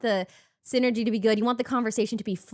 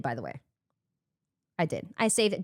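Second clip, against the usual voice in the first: ordinary delivery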